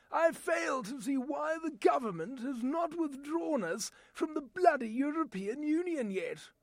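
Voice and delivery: British English accent, rising to an indignant rant; a grumpy Englishman demanding Brexit. Shown as a demonstration. An Englishman ranting about the EU, in an English accent. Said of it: "my voice talent demonstration".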